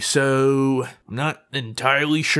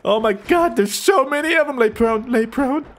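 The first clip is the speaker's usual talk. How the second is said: high-pitched, half-playful alarm; 7 semitones higher than usual. Pretend. Gamer warning team of swarm, playful alarm. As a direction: call out the warning in a raised pitch.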